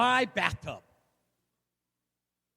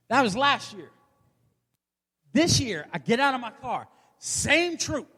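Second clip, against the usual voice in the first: ordinary delivery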